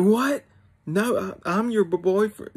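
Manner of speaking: very high, hammed-up outraged protest; mock indignant disbelief. Rejected boyfriend protesting to her, in mock disbelief. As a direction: imitate a spluttering, indignant objection, pitch way up.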